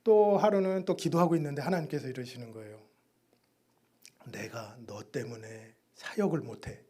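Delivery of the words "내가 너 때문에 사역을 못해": God's voice. Retold or imitated. God speaking in prayer.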